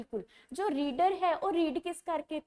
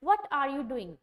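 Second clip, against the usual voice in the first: "What" punched loud, the following words weaker and falling; stress on What; 4 dB louder, much slower than usual. Shown as a demonstration. Teacher questioning chattering pupils, stress on "What". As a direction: hit "What" hard, then ease off on the rest. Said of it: "what ਤੇ ਜ਼ਿਆਦਾ ਜ਼ੋਰ ਦੇ ਦੇਗਾ".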